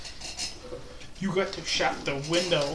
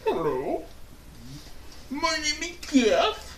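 space alien voice; 7 semitones higher, 4 dB quieter than usual. Pretend. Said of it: "talk like a space alien until your next turn".

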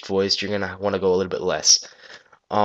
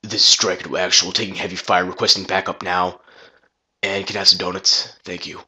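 slightly lowered, dry military radio call; deep, dry soldier voice. Shown as a demonstration. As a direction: make your voice a bit deeper and drier, like Master Chief. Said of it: "I made it a lot deeper and made it sound a little bit more dry".

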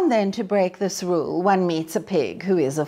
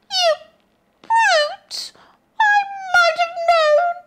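piglet; 5 dB louder than usual. A narrator's reading. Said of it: "Pig cried".

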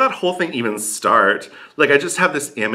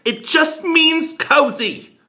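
high, drawn-out exasperation; fed-up, stretched-out retort; exasperated insistence; 14 semitones higher than usual. Acted. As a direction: insist slowly, pitch soaring with frustration. Exasperated rebuttal to the Dutch person.

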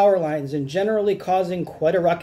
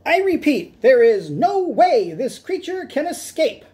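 high squeaky insistence, louder; nervous repetition; 9 semitones higher, 4 dB louder than usual. Acted. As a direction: repeat it again, now less sure. Repeats the claim as cat breaks free.

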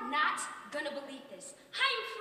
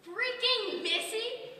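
slow, high, offended echo; outraged disbelief; 7 semitones higher, much slower than usual. Acted. Repeating the lady's insult to [name], slowly outraged. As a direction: drag it out in disbelief. Offended at being called Missy.

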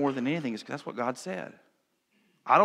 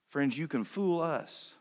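usual speaking voice

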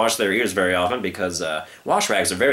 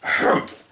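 snort. Acted. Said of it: "snorted the circus man".

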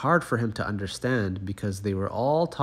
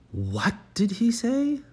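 much higher, startled question; baffled cry; 12 semitones higher than usual. Acted. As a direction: frogs baffled by the pig's ribbit, crying out high. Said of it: "cried the frogs".

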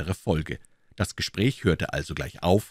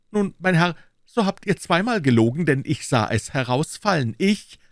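high, louder challenge; heated accusation; 9 semitones higher, 4 dB louder than usual. A narrator's reading. Gascon accusing Aramis of lying, heated. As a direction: call him out firmly. Acted quarrel, calling him a liar.

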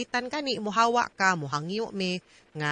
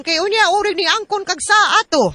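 mock scolding; 8 semitones higher, 5 dB louder than usual. Lawyer acting out a scolding.

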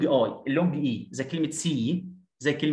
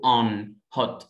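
American "on, hot" with open ah; American accent; 5 semitones lower than usual. Shown as a demonstration. Teacher contrasting with British for students, American accent. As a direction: unround "on" and "hot" to ah, American style. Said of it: "لكن في الأمريكان بقول".

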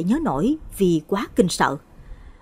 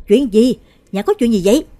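sudden loud shout, pitched up; hét lên; 4 semitones higher, 7 dB louder than usual. Acted. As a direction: yell it in alarm as you burst in. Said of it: "hét lên". (hét lớn hoảng hốt)

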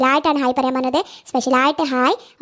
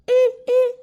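put-on much higher toddler voice babbling; baby talk; 10 semitones higher, much slower than usual. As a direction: babble like a small child to grandma. Toddler answers grandmother. (കൊഞ്ചി പറയുന്ന കുഞ്ഞ്)

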